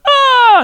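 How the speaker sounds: very high, loud mock scream; piercing open "ah"; joining-in scream. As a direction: re-enact screaming along with the crowd. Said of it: "I joined in going".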